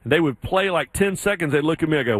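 ordinary delivery

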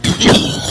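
short dry cough. Real, not acted. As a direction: cough once, mid-sentence. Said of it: "I'm coughing".

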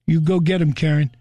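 ordinary talk

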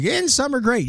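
high, sing-song mock enthusiasm; bright fake adult cheer; mock cheerful. Mocking adults' cheerful line.

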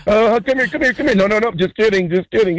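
a player teasing opponents with the ball, mock taunting; mock soccer player. Caller mimics soccer players passing.

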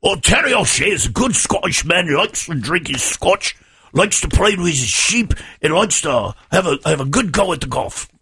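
lilting, hammed-up Scottish accent; Terry O'Shea. Co-host asked to play the Scotsman, Scottish accent. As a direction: play him in a stagey Scottish brogue. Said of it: "give me Terry O'Shea then".

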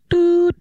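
high tone noise; phone tone. Acted. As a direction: mimic an automated tone. Imitated phone tone.

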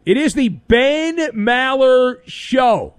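late-night host announcing his own show; radio show ID. Acted. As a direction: sell the show name. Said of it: "show ID".

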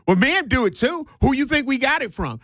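high, quick mock comeback; facetious retort. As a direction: imitate someone flippantly throwing the blame back. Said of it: "said in a facetious type manner".

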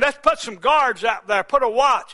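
high, loud preaching cry; preaching at full force. Acted. Preacher voicing the guards' plan, loud and high. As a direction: call it out over the congregation.

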